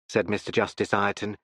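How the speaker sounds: usual speaking voice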